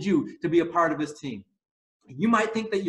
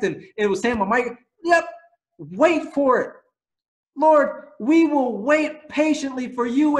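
ordinary delivery

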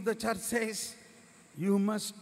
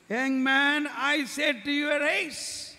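higher, louder command to rise from death; commanding call; 5 semitones higher, 4 dB louder than usual. Acted. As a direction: raise the dead with a ringing order. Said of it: "The Lord is asking me".

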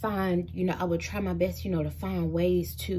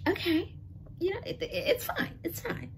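high, forced brightness, insisting all is fine; forced it's fine; 12 semitones higher, 3 dB quieter than usual. Retold or imitated. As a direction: sell "it's fine" while it clearly isn't. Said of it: "So I'm just like".

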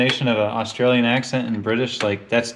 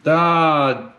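real annoyance while pulling the engine head; frustrated; 7 semitones higher than usual. Real, not acted. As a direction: vent at the endless parts.

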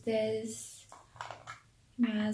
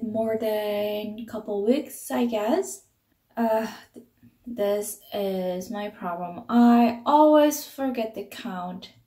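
talking while touching lips; applying lip balm while speaking; fingers on her lips; 6 dB louder than usual. Real, not acted. Seen 4: fingertips on lips while talking; brief gap at 1.6-2.2.

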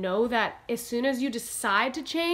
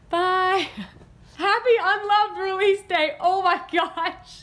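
giddy laughter; 11 semitones higher, 5 dB louder than usual. Real, not acted. Giddy sign-off on release day.

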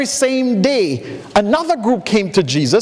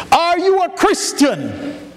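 higher, slow and earnest, a hint of a green preacher; earnest young preacher; 9 semitones higher, slower than usual. Young preacher to farmer, earnest and higher. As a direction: lean into the question like a keen new pastor.

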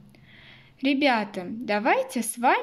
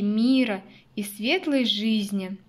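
normal talking voice

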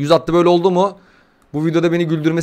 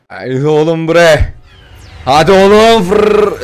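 slow, stretched urging growing louder; drawn-out hype; 6 dB louder, much slower than usual. Real, not acted. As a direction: urge the pack on, dragging each word. Hyping up the next pack. (uzata uzata gaza getirme)

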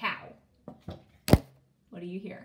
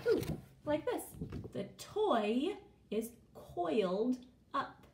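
ordinary talk, nothing marked

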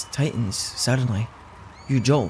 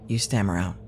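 usual speaking voice

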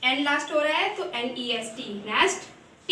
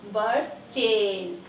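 "Words chain" said slowly and lower, word by word; announcing the topic; 4 semitones lower, much slower than usual. Shown as a demonstration. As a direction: present "words chain" slowly like a title on the board. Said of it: "मैं आज आपको English में क्या कराने वाली हूँ?". ("Words chain" धीरे-धीरे, शीर्षक की तरह)

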